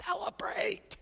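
"Celebrate" barely voiced, hushed and high; whispered word. Jesus pointedly urging the grumbling Pharisees, whispered.

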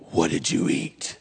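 wife in labor hisses at her husband, whispered; wife hissing. Retold or imitated. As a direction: whisper it like a threat.

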